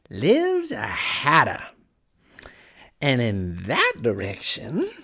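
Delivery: slow, drawn-out cat character voice; sly Cheshire Cat. A narrator's reading. Cat answering Alice's question, slow and teasing. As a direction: stretch the words out in lazy riddles.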